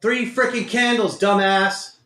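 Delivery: real frustrated outburst; angry self-scolding. Real, not acted. Berating himself over losing trade.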